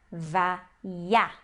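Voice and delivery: "Va, ya" as separate syllables, each with A; syllables sounded out. Shown as a demonstration. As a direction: sound out "Va", pause, then "ya". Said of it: "a gente vai ler como um A". ("Va, ya" em sílabas separadas, com A)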